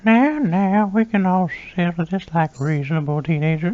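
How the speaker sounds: put-on high, creepy old-man voice; Herbert. Herbert soothing arguing teenagers, high and creepy. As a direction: coax them with a leering old man's calm. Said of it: "when they want me to do the Herbert voice".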